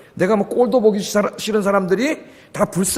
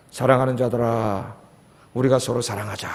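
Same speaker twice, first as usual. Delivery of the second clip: tender apostle; 9 semitones lower, 8 dB quieter than usual. Retold or imitated. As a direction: gently urge the brothers to love. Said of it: "성령 충만해지니까".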